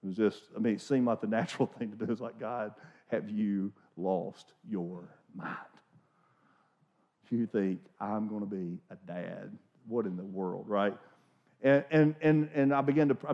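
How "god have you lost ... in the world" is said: slow, low, disbelieving plea to God; mock-panicked young father praying; panicked prayer. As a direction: ask God if he's lost his mind, half joking.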